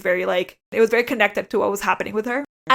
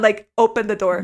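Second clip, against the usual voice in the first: ordinary delivery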